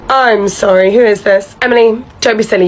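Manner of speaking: ordinary talk, nothing marked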